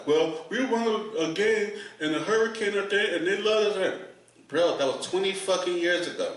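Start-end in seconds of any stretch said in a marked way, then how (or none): 0.1-4.0 mocking Saints fan. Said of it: "I said".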